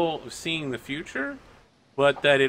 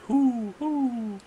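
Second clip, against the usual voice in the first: high hooting owl calls; high owl who-who hoots; 11 semitones higher, much slower than usual. A narrator's reading. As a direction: hoot it up high like a chorus of owls. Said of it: "they're all like".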